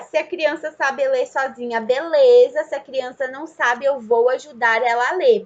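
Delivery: ordinary delivery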